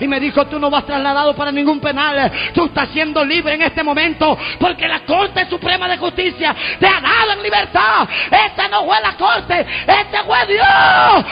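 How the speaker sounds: high-pitched revival preacher, rising into drawn-out shouts; full-force preaching. Acted. Preacher telling congregation God set him free, building to shouted praise. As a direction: proclaim it to the crowd, stretching the final words.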